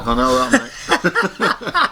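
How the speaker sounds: said through a high laugh. Real, not acted. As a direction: laugh out the words, amused. Laughing at friend's geography joke.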